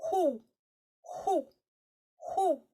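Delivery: short high hoots, each falling; loud, repeated owl hooting. Shown as a demonstration. Teacher practising the hoo with the class, owl hoot. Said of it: "we'll just practice the hoo".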